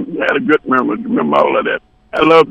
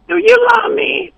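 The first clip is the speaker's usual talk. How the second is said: very high, wheedling falsetto; mock female falsetto. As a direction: plead in a put-on high woman's voice. Voices woman in retold dialogue.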